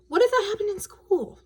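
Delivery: put-on high little girl voice; Wendy's voice. A narrator's reading. Sister asks brother, worried little girl voice. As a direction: lift into a young girl's worried question. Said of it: "Wendy said".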